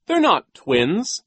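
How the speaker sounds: put-on high, loud girl's correction; insistent outcry; crying out. A narrator's reading. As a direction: object slowly and emphatically. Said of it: "Violet cried".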